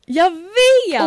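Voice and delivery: loud high exclaim; excited cry. Real, not acted. Sharing joy about the trip, excited. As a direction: shout it gleefully.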